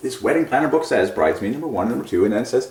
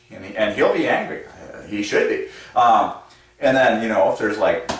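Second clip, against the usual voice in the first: ordinary talk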